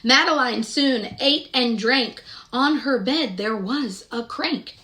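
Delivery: gentle, sing-song picture-book narration; storybook reading. Acted. Reading Madeline aloud to young children, sing-song storytelling. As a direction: read the rhyme aloud to little listeners.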